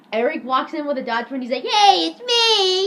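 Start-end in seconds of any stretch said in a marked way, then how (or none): 1.6-2.9 high, loud, drawn-out announcement; hammed-up holler; mock grand entrance. Eric bursts in with a dodgeball, loud mock holler.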